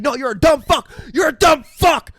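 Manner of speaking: high, loud mock screaming insult; retold shout, second hit even harder; imitated screaming. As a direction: scream it like an enraged drummer. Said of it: "And just starts screaming at him".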